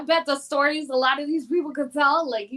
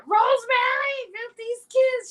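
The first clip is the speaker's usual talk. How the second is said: put-on high-pitched yell with an Italian accent; yelling grandma; 13 semitones higher than usual. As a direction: call out for help in a heavy Italian accent. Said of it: "she'd be yelling at that".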